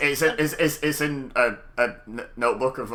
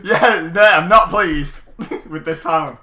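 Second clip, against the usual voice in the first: laughing; 4 semitones higher, 10 dB louder, slower than usual. Real, not acted. Laughing exasperation.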